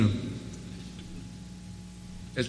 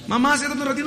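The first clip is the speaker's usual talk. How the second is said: put-on coaxing child; 12 semitones higher than usual. Child urging busy mother.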